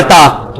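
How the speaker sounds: "تا" with a light Urdu t; plain unrounded t in "تا"; simple thin t. Shown as a demonstration. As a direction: say "تا" thin, tongue flat. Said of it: "بالکل simple ہے".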